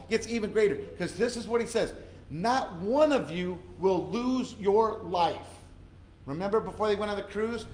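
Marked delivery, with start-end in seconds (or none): 2.3-5.5 slow, loud, proclaiming promise; Paul's proclamation. Retold or imitated. Preacher voicing Paul to the crew, slow loud proclamation. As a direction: declare it like an apostle.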